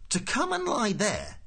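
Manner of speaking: high, slow, incredulous question; spelling it out. A narrator's reading. Prosser works out what Ford wants, slow high disbelief. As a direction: spell it out slowly, pitched high. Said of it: "spelling out this new thought to himself".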